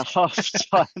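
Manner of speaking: ordinary talk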